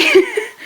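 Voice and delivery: squealing laugh; a sudden giggle; high burst of laughter. Real, not acted. Greeting boyfriend Corey.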